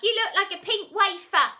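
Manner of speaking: loud high mocking jeer; loud cheeky heckle. A narrator's reading. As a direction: taunt them from the back of the hall. Joker keeps heckling.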